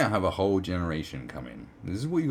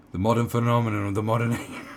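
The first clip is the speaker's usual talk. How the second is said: louder mocking repeat with a laugh on "age"; 4 semitones higher, 8 dB louder than usual. Shown as a demonstration. Mocking the article's phrase to viewers, laughing. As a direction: echo the phrase, scoffing and laughing.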